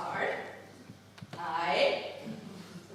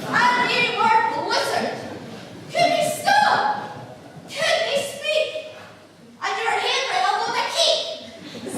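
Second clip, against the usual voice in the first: loud, high broad Scottish wife scolding; furious Kate O'Shanter; 8 semitones higher, 7 dB louder than usual. Acted. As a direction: tear into the drunk husband in broad Scots. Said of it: "Just as I imagined Kate O'Shanter did".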